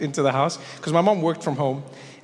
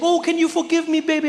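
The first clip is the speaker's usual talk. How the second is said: pleading mom; 12 semitones higher than usual. Retold or imitated. Mom to her son she forgot, high pleading. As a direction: beg forgiveness like a sorry mother.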